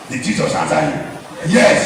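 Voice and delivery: ordinary talk, nothing marked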